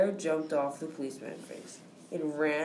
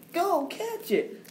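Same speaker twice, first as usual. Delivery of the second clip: high, slow worried mother; 13 semitones higher, slower than usual. Acted. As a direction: ask it like an anxious mom. Mother orders.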